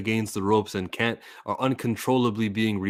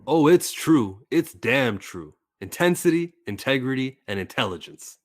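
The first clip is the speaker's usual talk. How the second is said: Kurt Angle promo; 5 semitones higher, 7 dB louder than usual. Pretend. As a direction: boom it out like a wrestling promo. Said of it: "Who's your favorite wrestler voice to, to imitate?".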